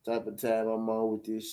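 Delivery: an unbothered fighter brushing it off, low and slow; quoting Crawford. Retold or imitated. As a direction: drop low and slow, lazily mimicking him. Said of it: "He said".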